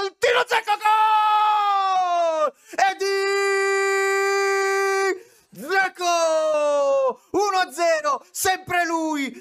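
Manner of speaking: screaming goal call, rising high; breathless italian goal commentary. Real, not acted. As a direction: yell the goal at the top of your lungs.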